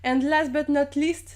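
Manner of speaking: slow, careful English phrase in a French accent. Shown as a demonstration. Vlogger shows off an English phrase she loves, slow French-accented English. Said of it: "j'ai voulu faire une phrase anglaise que j'aime trop".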